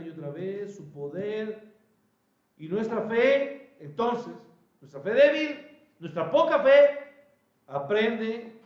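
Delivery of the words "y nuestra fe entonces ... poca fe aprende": rising preacher's cry. Acted.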